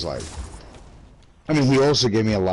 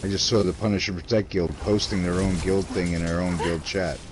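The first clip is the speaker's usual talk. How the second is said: ordinary talk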